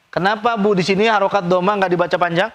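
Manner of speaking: ordinary talk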